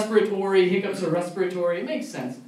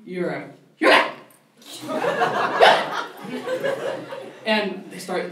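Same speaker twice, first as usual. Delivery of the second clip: usual speaking voice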